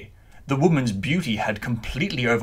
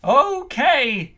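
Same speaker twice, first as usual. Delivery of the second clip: shouted back. A narrator's reading. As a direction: shout it right back at her. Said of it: "the clown shouted back".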